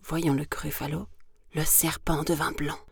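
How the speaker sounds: plain reading voice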